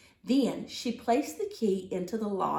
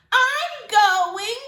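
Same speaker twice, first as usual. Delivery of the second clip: put-on higher, louder little boy insisting; whiny little brother; 11 semitones higher, 10 dB louder than usual. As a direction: demand it like a small boy. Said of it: "said her little brother".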